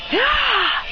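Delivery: high acted "ah" yell; battle cry. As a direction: let out a high attacking cry. Fighters cry out as attacking.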